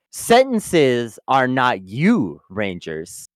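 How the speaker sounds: slow, low mock-Jesus solemnity; drawn-out cryptic guru, dropping away at the end; Demetria. Demetria correcting the Rangers, solemn fake messiah. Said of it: "Demetria is like someone badly imitating Jesus".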